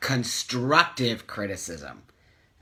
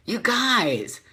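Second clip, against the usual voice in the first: usual speaking voice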